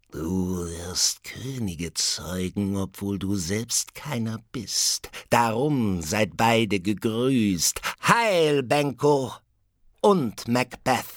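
slow eerie prophecy rising to a high, loud cry; witch building to a shriek. Acted. Third witch hailing Benko and Macbeth before vanishing. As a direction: build from ominous to a triumphant shriek.

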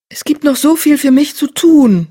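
high, loud desperate plea; pleading. Acted. Dying cat pleads.

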